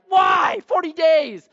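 loud, high wail of regret; anguished outcry. Retold or imitated. Moses regretting the broken tablets, loud anguished cry. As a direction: cry out over forty wasted days. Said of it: "You're like".

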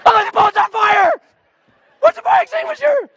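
very high, loud mock panic; hammed-up frantic alarm; freaked-out screaming. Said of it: "I freak out".

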